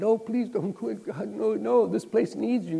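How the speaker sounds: high, begging plea; pleading boss. Retold or imitated. Big boss on the phone begging Liz, high pleading. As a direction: beg her to stay, pitch up. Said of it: "big boss called up and said".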